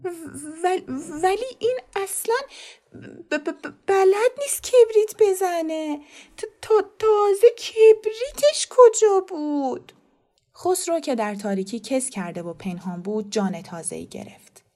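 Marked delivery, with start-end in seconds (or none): 0.0-9.9 high, loud, slow stammer in panic. Acted. The mother stammers in defense of her son before everyone, high, loud and slow. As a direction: trip over each syllable, pitch up and frightened. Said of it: "با لکنت گفت".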